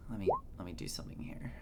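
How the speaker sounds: fast unvoiced whisper; whispered note while checking something; whispered aside. Real, not acted. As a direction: mutter it to yourself, fast. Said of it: "aside to players".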